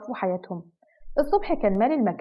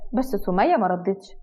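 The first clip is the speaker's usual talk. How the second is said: ordinary delivery, nothing marked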